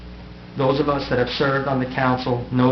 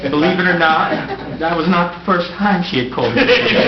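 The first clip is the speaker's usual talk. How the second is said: laughing; 5 semitones higher, 5 dB louder than usual. Real, not acted. Laughing through his own joke.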